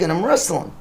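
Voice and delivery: normal talking voice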